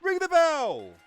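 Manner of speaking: bursting with hype, call for the bell; excited shout. Real, not acted. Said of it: "Don't know why I got excited".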